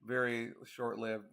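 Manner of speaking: normal talking voice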